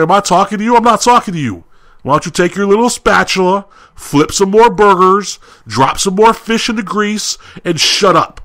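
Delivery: high-pitched, heated re-enacted telling-off; angry retort. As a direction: act out lashing out at the cook. Retold quarrel with the cook.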